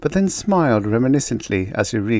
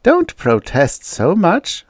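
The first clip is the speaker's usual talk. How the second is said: slow, high mocking taunt; acted drawn-out jeer; 10 semitones higher, 4 dB louder, slower than usual. A narrator's reading. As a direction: needle her, drawing it out. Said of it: "the blonde jeered".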